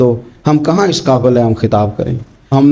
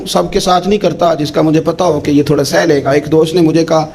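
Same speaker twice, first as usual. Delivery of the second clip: normal talking voice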